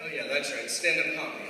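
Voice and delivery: deadpan realization. Acted. Mock remembering what he's doing.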